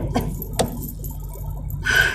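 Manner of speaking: long breathy sigh; exasperated sigh. Real, not acted. Venting about a child support dispute, exasperated sigh. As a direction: let out a weary breath. Sighs over the situation.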